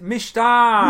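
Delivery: "Mishta" with a slurred sh, high and loud; misspelt Mister. Retold or imitated. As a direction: say "Mishta" with sh in place of s. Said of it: "that's terrible spelling right there".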